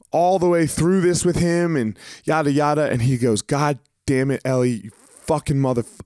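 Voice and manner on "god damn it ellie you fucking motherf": normal talking voice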